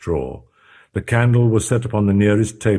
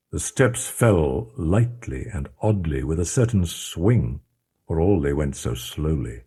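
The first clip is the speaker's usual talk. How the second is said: ordinary delivery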